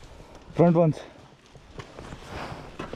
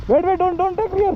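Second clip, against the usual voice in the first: urgent high call; 11 semitones higher than usual. Real, not acted. Urgent warning on bike climb.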